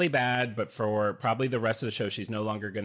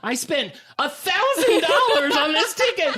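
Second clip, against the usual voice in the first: high, loud indignant protest; hammed-up fury, pitched way up; retold outrage; 14 semitones higher, 6 dB louder than usual. To ushers over a costly ticket, retold outrage.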